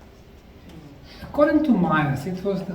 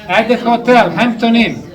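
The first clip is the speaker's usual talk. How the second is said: much louder, pitched up to carry; louder call to the audience; 5 semitones higher, 12 dB louder than usual. Real, not acted. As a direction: call it across the room. Calling out across the room.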